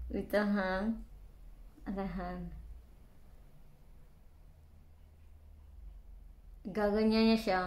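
lip pulled down while talking; tucking something under lip; fingers in mouth. Real, not acted. Seen 1: Fingers pull lower lip down, placing small item under it.

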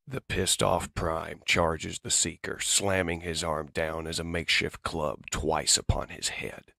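ordinary delivery

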